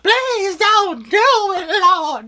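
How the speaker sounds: hammed-up, high-pitched begging wail; mock-sobbing cries, stretched out at the end; screaming and hollering. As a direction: plead for mercy like a terrified kid. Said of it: "now I'm screaming and hollering".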